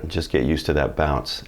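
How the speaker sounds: ordinary delivery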